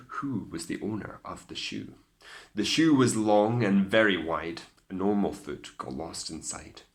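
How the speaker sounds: usual speaking voice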